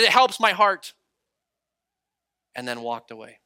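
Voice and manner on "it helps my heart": loud, high, shouted confession; imitating a man hollering from afar; retold yelling. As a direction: shout your story across a park. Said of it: "He's yelling across the park".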